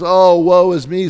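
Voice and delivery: mock woe is me. Preacher mocking self-pity, hammed-up lament. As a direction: wail it like a martyr. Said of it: "this self-pity".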